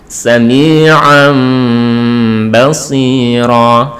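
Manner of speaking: slow recitation, "sami'an" humming into "basira" with lips not quite closed; "sami'an basira" with a long, loosely lipped m sound; lips barely apart. Shown as a demonstration. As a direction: hold the nasal ending of "sami'an" with a thin lip gap before "basira". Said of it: "ada rongga tipis antara dua bibir".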